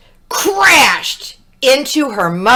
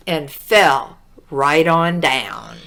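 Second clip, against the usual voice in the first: plain reading voice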